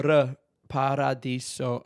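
slow Paradiso with an Italian rolled R. Shown as a demonstration. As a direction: roll the R on its own, then in Paradiso. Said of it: "when I go".

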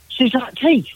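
high, alarmed imitation of a frightened hunter; scared cry. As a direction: squeal it like you just saw fangs. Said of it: "And he's like".

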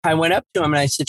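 ordinary talk, nothing marked

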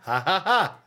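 mock Chef John laugh. Friends mocking a chef's laugh, laughing through it. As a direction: lightly mimic the chef's canned laugh. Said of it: "Chef John talks so".

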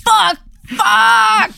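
high, loud cry of dismay; anguished shout. Real, not acted. Player hears her character killed, real anguished high shout. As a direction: yell it like you just got chopped in half.

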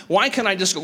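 ordinary delivery

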